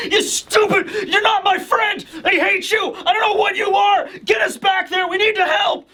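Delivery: high, strained mock shouting; hammed-up frantic yelling in a very high voice; mock screaming. Yamada beating Kurogiri, screaming in a frenzy. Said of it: "he's just screaming to Aizawa".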